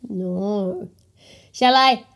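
ordinary delivery

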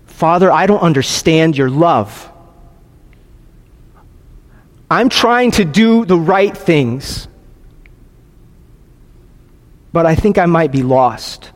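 measured prayer with long pauses; confessional prayer. Acted. Pastor modelling a doubter's prayer to the Father, slow and subdued. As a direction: pray it slowly, thought by thought. Leads a second silent prayer.

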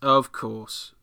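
ordinary talk, nothing marked

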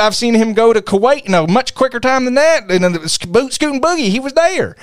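high, excited Southern twang; Southern accent. Pretend. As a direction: argue it in a hammed-up Southern voice. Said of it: "I really like using a Southern accent".

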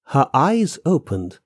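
plain reading voice

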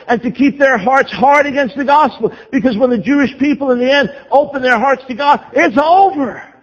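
high-pitched, driving sermon delivery; impassioned preaching. Real, not acted. Preaches at full force.

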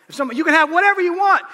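high, loud and fast mock glee; mock excited shout. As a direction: blurt it out like a greedy child. Preacher voicing a greedy wish.

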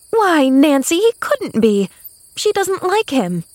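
put-on high, incredulous girl's voice; shocked objection. A narrator's reading. Girl rejecting the maid's revelation, objecting. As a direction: object in disbelief as Pollyanna. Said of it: "objected Pollyanna".

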